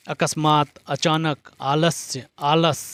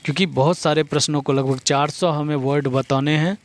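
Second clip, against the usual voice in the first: rapid-fire explanation; fast. Real, not acted. Teacher racing to cover four hundred words, fast. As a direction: rattle it off quickly to save time. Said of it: "मैं थोड़ा fast, बोलता हूँ".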